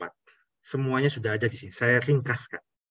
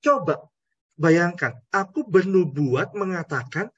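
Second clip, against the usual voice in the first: higher-pitched, aggrieved prophet; indignant Jonah; 6 semitones higher than usual. Acted. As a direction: complain like a humiliated prophet. Jonah's embarrassed grievance.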